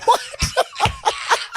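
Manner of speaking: helpless high laugh; high squealing laugh. Real, not acted. Cracking up at the profile.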